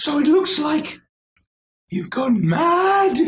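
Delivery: high hen voice pronouncing doom; hen verdict. Acted. GM voice announcing madness.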